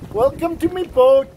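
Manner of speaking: slow, loud, badly faked Scottish accent; hammed-up Scottish captain's welcome; terrible Scottish accent. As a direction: boom it out in a bad Scottish accent. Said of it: "That's a terrible Scottish accent".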